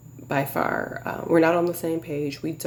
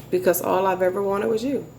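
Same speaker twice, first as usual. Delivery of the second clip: usual speaking voice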